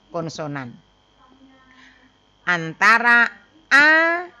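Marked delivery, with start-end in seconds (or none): 3.7-4.3 loud, high, distinct A; clearly articulated A. Shown as a demonstration. Teacher showing students that vowels must be clear, clearly articulated A. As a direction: sound out A clearly and forcefully. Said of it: "dengan U harus jelas".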